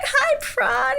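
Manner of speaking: very high, loud squealed hello; excited fan greeting. Retold or imitated. Retold greeting to favourite idol.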